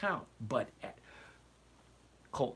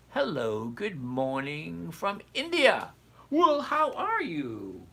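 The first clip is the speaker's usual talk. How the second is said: big, loud, drawn-out hello, swooping high; hammed-up greeting; 4 semitones higher, 6 dB louder, slower than usual. Streamer greets a viewer from India, hammed-up greeting. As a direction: greet them with exaggerated delight, stretching the words.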